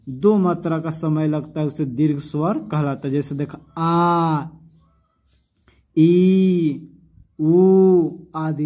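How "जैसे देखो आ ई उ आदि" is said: आ, ई, उ drawn out long, louder; long vowels. Shown as a demonstration. Teacher drawing out the long vowels for students. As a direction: stretch each vowel आ ई उ slowly. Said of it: "इसमें ज़्यादा time लगा ना".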